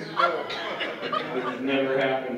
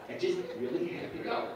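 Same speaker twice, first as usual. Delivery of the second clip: hushed; 5 semitones higher, 7 dB quieter than usual. Retold or imitated. Desperate to use bathroom.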